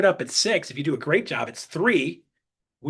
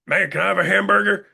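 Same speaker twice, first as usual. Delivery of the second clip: loud, put-on voice of a hamburger customer; Daniel Pere; 3 semitones higher, 5 dB louder than usual. Hungry customer at the window ordering a burger, loud put-on voice. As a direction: imitate the customer's way of talking, louder. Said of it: "'Cause that's how he talks".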